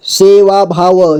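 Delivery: सेवा भाव slowly, final व sounded separately; syllable by syllable. Shown as a demonstration. Teacher breaking down सेवा भाव for learners, slowly syllable by syllable. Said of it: "जोड़ जोड़ करके".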